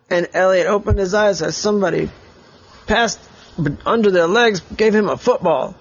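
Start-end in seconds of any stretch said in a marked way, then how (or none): none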